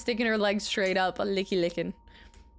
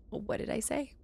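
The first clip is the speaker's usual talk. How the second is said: ordinary talk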